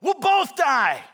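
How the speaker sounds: high, loud, desperate-sounding shout; yelling back. Acted. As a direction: yell back, stretching the last words. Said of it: "Kazim yells back at him".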